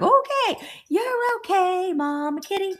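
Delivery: high, slow cooing voice to a scared cat; soothing the cat. Retold or imitated. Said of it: "And I was like".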